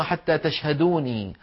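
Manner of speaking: slow "حتى تشهدوني" ending in long ii; الأصل بياء المتكلم. Shown as a demonstration. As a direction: sound the final ya of "تشهدوني", drawn out. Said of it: "هذه أصلها". («تشهدوني» بإثبات الياء في آخرها)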